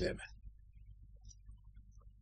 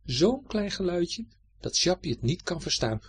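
plain reading voice

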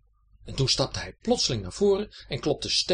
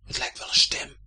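voiceless, forceful stage whisper; 4 dB louder than usual. A narrator's reading. Gerda hears mumbling ahead and tells her brother, whispered. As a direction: whisper it to your companion. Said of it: "fluisterde Gerda".

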